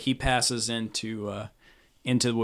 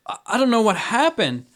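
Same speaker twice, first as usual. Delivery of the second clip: high, louder, stammering alarm; flustered stewardess; 10 semitones higher, 6 dB louder than usual. Acted. Stewardess checking a collapsed passenger, flustered.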